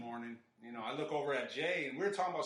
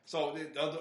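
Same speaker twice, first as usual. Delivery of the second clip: ordinary talk, nothing marked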